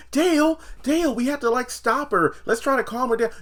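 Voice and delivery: very high, pleading imitation; high fussy voice urging calm; hammed-up squeaky Sharon; mock Sharon. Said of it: "usually he's like".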